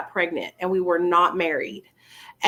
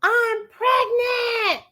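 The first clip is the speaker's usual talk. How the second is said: high, drawn-out excited shout; thrilled high-pitched yell; excited scream; much slower than usual. Retold or imitated. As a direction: scream the news to the next room. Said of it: "I screamed to my husband".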